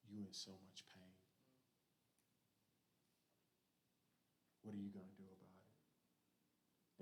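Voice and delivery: quiet as a nagging thought inside the head; little inner voice. Acted. Depression's voice in a sufferer's head, quiet and low. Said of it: "That little voice going in your head".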